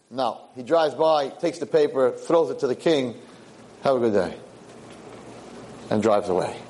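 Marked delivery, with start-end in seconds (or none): 3.8-4.3 lower, unenthusiastic send-off; perfunctory greeting. Acted. Boy driving past the king, perfunctory. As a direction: toss it off without the usual warmth. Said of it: "doesn't give the king the old good morning".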